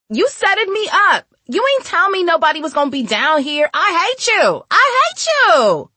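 put-on high, loud outraged girl; furious screaming. A narrator's reading. Girl accuses Naylor of setting her up, screaming.

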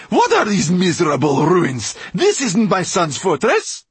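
very high, loud character voice; Mortola's outraged cry. A narrator's reading. Mortola facing her son's ruined fortress, outraged cry. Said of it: "Mortola's voice echoed from the dead walls".

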